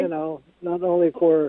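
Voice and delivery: faint voice, phone away from mouth; talking too far from the phone; phone held away. Real, not acted. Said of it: "We, we don't hear you too well".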